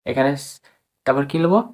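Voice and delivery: ordinary talk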